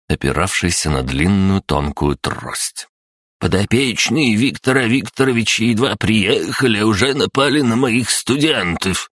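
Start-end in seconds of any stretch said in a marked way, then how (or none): 3.4-9.1 высокий дребезжащий стариковский голос; всплеснув руками, по-стариковски; возмущённый старик. Acted. (голос сердитого старика)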